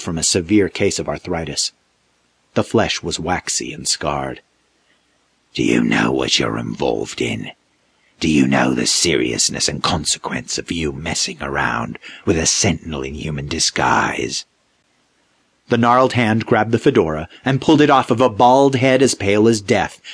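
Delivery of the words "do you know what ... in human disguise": low, grave monster warning; menacing creature. A narrator's reading. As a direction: loom over him. Creature warns Austin.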